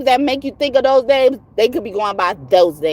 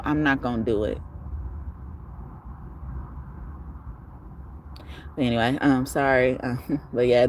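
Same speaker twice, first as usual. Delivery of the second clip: lower, softer channel outro; soft outro sign-off; 7 semitones lower, 6 dB quieter than usual. Real, not acted. Reader inviting new viewers to subscribe, soft sign-off voice. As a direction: wind down gently, inviting viewers to stay.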